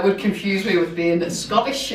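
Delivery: ordinary delivery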